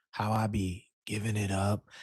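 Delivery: soft, low sultry voice; sultry podcast voice. Pretend. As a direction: ease it out like a smooth host. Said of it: "calm, sultry podcasting voice".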